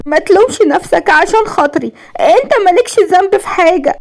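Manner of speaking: high-pitched acted crying; wailing tearfully. A narrator's reading. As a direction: beg through tears in a high voice. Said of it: "وهي بتعيط وبتقول بنحيب". (بنحيب وعياط)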